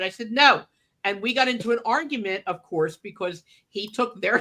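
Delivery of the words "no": firm no. Retold or imitated. Retold argument, final refusal.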